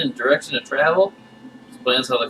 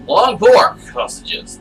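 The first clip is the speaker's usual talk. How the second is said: "Long pork sausages" very slow, higher and louder on "pork"; odd emphasis; 8 semitones higher, 6 dB louder, much slower than usual. Acted. Chuck tells the guest about his sausages, slow and louder, stressing pork. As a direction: hit "pork" hard and slow it all down. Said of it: "I emphasize words oddly".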